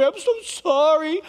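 hammed-up fake contrition; mock apology. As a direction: parody a hollow, sing-song apology. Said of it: "you're always very sorry".

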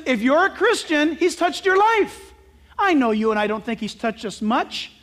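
normal talking voice

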